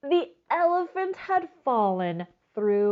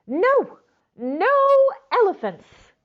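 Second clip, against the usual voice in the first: high, loud shout of refusal; 13 semitones higher, 9 dB louder than usual. Acted. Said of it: "shouted Grandma".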